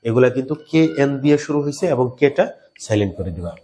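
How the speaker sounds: ordinary talk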